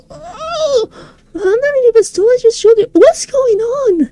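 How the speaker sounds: alarmed. Acted. Realizing she is shrinking.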